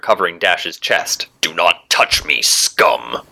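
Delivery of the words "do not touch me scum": low, slow, contemptuous hero voice; disgusted hero. A narrator's reading. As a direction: draw each word out low with disgust. Hero recoils from villain in disgust.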